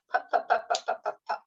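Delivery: pup, pup, pup with a strong puff of air on each p; a teacher drilling the p in pup; P sound demo. Shown as a demonstration. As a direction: repeat pup crisply, popping each p. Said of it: "That's the P sound".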